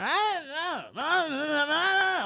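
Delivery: very high-pitched wordless mock yelling; imitated screaming. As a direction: imitate a heckler's wailing yell without real volume. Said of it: "like, really loud".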